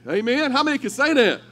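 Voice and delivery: loud, high call to the church; preacher rallying. Acted. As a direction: stir the room. Rallying the congregation.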